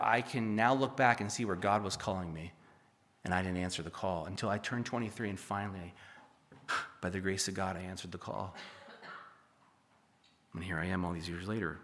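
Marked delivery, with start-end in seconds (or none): none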